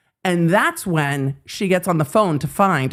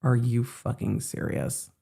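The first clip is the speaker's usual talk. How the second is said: low, quiet, appalled; disgusted disbelief; 7 semitones lower, 9 dB quieter than usual. Retold or imitated. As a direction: say it low and dropped, horrified. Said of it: "Not like".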